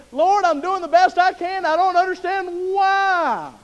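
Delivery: high, loud pleading prayer breaking into a cry. Retold or imitated. Believer cries out to God in high, loud pleading. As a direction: beg God for answers, voice cracking. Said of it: "Anybody ever said".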